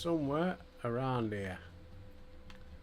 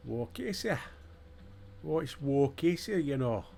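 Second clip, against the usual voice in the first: higher, hammed-up Geordie; bad Geordie accent; 4 semitones higher than usual. Player puzzling over a character's name, bad Geordie accent. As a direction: attempt a terrible Geordie accent. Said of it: "that's the worst Geordie accent going".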